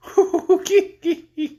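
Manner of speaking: high, chuckling laugh of a fat king; jolly laugh. Acted. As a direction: laugh merrily at your own joke. King laughs at own joke.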